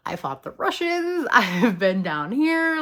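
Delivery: high-pitched sassy boast, slowing down. Acted. Erica telling Eddie what she's been through, sassy. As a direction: brag with drawn-out attitude. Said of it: "Erica is this little sassy monster".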